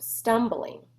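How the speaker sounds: plain reading voice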